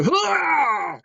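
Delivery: high, drawn-out imitated cry; sudden high holler, imitating the noise heard; imitated commotion noise. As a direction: let out a long high whoop mid-story. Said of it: "all of a sudden I heard".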